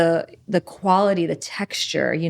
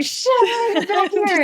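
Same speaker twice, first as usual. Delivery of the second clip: mock dismay; 14 semitones higher than usual. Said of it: "And you're like".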